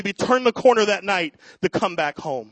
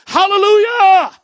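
full high joyful shout; father shouting praise while running; yelled hallelujah; 10 semitones higher, 10 dB louder than usual. Retold or imitated. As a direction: throw it out loud and high like running with joy. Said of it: "he yelled".

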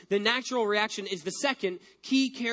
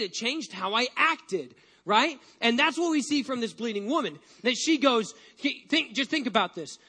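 ordinary delivery

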